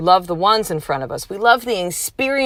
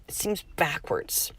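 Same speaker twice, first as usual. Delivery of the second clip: a short confiding whisper; 12 dB quieter than usual. Real, not acted. Speaker whispering to her listeners that it feels backwards. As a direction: let it slip out as a hushed aside.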